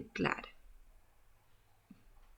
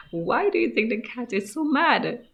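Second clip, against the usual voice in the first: ordinary delivery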